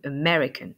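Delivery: "American" opening on a weak "uh", stress on MER; unaccented first A. Shown as a demonstration. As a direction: say "American" with the first A reduced to schwa. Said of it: "c'est un son inaccentué en anglais". (« American » avec un premier A inaccentué, dit « e »)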